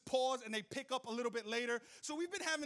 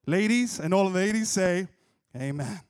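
much louder, lower preacher call; call and response; 5 semitones lower, 14 dB louder than usual. Acted. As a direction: summon the amen from the ladies.

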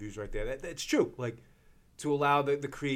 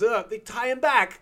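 put-on high little kid voice; child voice; 10 semitones higher, 7 dB louder than usual. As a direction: answer like a small child. Child answering Grover.

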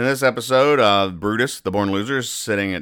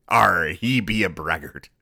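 slow mock pirate voice; hammed-up pirate impression. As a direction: draw it out like a comic pirate. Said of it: "thing a pirate might do".

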